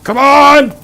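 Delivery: high hyped battle yell; loud gung-ho soldier. Acted. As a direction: roar it like a charging soldier.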